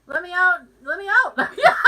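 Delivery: loud, high mock pleading, laughing; trapped prisoner. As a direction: cry out to be released, hammed-up. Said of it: "It's like I'm in prison".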